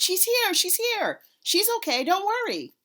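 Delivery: high, loud reassuring shout. A narrator's reading. Aunt shouting to the family that grandma is safe. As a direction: shout it across the crowd to calm them. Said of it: "My Aunt Ida waved and shouted".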